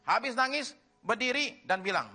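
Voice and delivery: high-pitched, insistent preaching voice; forceful preaching. Real, not acted. Preacher sums up lesson on praise and grief, forceful preaching. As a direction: drive the point home to the congregation.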